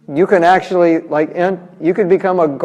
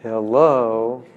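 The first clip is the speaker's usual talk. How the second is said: sarcastic hello; 6 semitones lower than usual. Retold or imitated. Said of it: "you can see what Prabhupada's saying".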